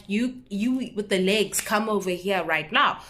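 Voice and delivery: ordinary delivery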